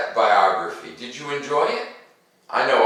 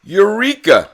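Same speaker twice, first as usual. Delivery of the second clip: high, bright cry of discovery; sudden triumphant eureka; excited eureka; 10 semitones higher, 8 dB louder than usual. Acted. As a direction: shout it like you just figured it out. Inventor's moment of discovery.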